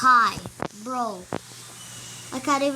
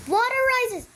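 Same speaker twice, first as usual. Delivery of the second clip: high, loud announcer call; 13 semitones higher, 4 dB louder than usual. Pretend. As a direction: call it out like a game host. Announcing rising water.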